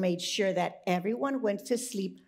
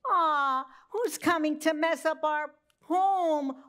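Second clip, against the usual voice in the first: oinking pigs; 6 semitones higher, 3 dB louder than usual. Acted. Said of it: "oinked the pigs".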